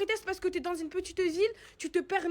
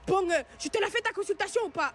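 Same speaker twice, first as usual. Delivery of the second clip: a higher, louder jab, mimicking the doctor's threat; mock doctor threat; 5 semitones higher, 5 dB louder than usual. Retelling the doctor holding her card hostage, mock higher louder threat. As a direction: throw it back like the rude doctor did. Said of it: "il ... fait".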